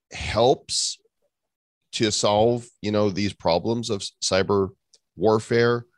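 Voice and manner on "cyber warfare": ordinary talk, nothing marked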